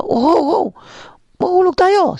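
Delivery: high terrified yelling at the horse; panicked shouting. Acted. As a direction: shout in terror near the cliff. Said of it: "sigaw ng sigaw".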